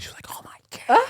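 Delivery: unvoiced mock gasp of disbelief, all whisper; whispered freak-out. Man imitates lady behind the glass, in a whispered freak-out. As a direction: whisper it like an onlooker losing it.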